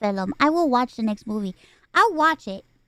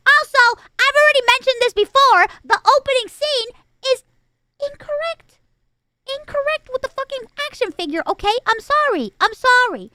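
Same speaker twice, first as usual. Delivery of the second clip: higher, louder hammed-up indignant rant; mock outrage; 10 semitones higher, 5 dB louder than usual. Reviewer to viewers, hammed-up mock fury over a toy. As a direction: play at being furious over a tiny detail.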